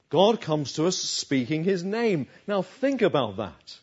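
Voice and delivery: ordinary delivery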